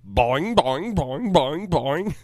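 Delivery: high bouncy boing noises; springy cartoon bouncing sounds; bouncy springy boingy voice. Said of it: "Does Grimace talk?".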